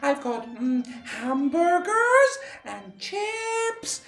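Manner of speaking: very high, sing-song luring voice; nice old lady. A narrator's reading. Witch luring children with food, falsely nice old lady. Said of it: "her best nice old lady voice".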